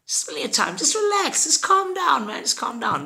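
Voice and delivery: high put-on voice, calming him down; soothing friend Magoro. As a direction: tell him to relax in a high silly voice.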